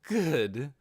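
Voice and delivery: bright, pleased little word, voiced high; happy Albedo. Albedo smiling at Ainz, pleased. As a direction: sound delighted with him. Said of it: "She smiled happily and said".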